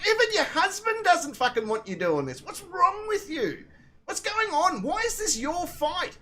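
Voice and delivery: high, incredulous telling-off; retold indignant scolding. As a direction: demand answers, pitched well above normal talk. Retold argument with angry stranger.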